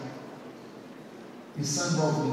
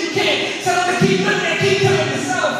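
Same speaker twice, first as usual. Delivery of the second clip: higher, louder insistence; rising forceful climax; 13 semitones higher, 10 dB louder than usual. Acted. God scolding the mother, high and forceful. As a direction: drive the point home hard.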